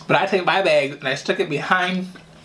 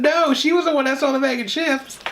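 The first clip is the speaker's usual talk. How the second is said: put-on childish blaming; 11 semitones higher than usual. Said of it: "I said".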